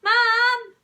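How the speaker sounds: loud, high holler; child shouting for a parent. A narrator's reading. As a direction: yell for Mum. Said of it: "shouted Peter".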